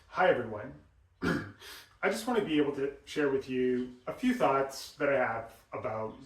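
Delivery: too much air on every word; breathy demo. Shown as a demonstration. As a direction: let the breath spill out on each word. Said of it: "I'm breathy".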